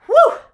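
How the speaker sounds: loud high blown-out whew; exhausted whew. Pretend. Picturing bedtime after a full day, a loud high whew. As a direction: blow out a big tired breath. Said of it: "go to bed at night like I do".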